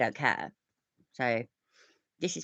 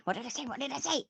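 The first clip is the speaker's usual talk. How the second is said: gleeful told-you-so; 10 semitones higher, 4 dB quieter, faster than usual. Real, not acted. Gloating at being right.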